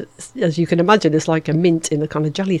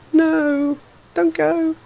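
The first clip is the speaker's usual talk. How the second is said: mock plea; 12 semitones higher, much slower than usual. Pleading with summer, mock.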